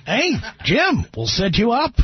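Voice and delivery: ordinary delivery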